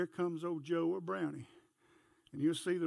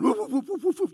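rapid loud higher barks; fast excited barking; 7 semitones higher, 10 dB louder than usual. Shown as a demonstration. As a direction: bark fast like a dog closing in. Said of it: "hear me up here going".

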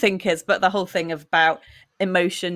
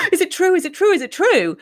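higher, louder, urgent repeated question; frantic question; 11 semitones higher, 5 dB louder than usual. Retold or imitated. Daughter asking her mother about exams, frantic and high. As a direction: plead for the news to be false.

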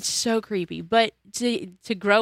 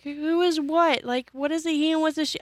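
put-on baffled kid; 9 semitones higher than usual. Confused kid retold.

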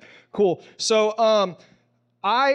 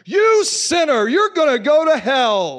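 much higher, louder, slow hellfire prophet; condemning prophet; 7 semitones higher, 8 dB louder, slower than usual. Retold or imitated. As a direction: thunder it down like a scary preacher calling out sin. Feared prophet condemning a sinner.

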